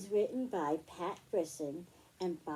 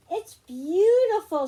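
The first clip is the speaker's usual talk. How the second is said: much higher, louder gushing praise; delighted mother; 11 semitones higher, 16 dB louder than usual. Acted. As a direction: gush over the gift like a thrilled mom. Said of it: "said his mother".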